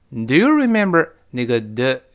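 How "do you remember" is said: "Do you" glided over, "remember" higher and stressed. Shown as a demonstration. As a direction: skim "Do you", hit the middle of "remember". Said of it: "remember我们是重读".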